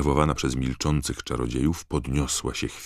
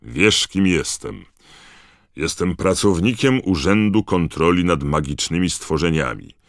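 loud, raised, authoritative statement; stern; 5 semitones higher, 7 dB louder than usual. A narrator's reading. Digory the official confronting the elf, stern. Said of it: "powiedział pan Digory surowym tonem".

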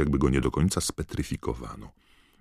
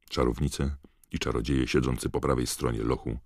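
ordinary delivery

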